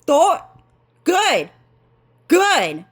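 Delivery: high, squeaky mock voice of the fake dad; imitating Trio. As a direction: mimic the stepdad in a put-on high voice. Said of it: "He's like".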